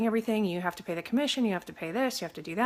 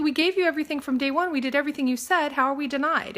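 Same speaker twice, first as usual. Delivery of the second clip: indignant protest; 7 semitones higher, 4 dB louder than usual. Retold or imitated. Retold confrontation with the lender.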